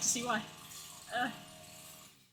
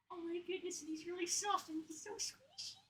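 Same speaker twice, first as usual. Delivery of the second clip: tender coo; 2 semitones higher, 11 dB quieter than usual. Real, not acted. Softly adoring the plush.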